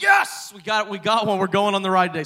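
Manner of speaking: high, loud burst of triumph; excited shout. Retold or imitated. Pastor reliving winning the virtual queue, shouting with joy. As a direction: yell it like you just won the lottery. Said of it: "I was like".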